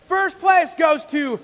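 slow, loud shout of a ceremony host; booming suspense call; announcer. Retold or imitated. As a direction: draw out the suspense.

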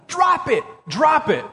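high retold shout that drops off; retold yell at the bear. Pastor recalling yelling at the bear, mild mock yell. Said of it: "When I'm yelling at that stupid bear".

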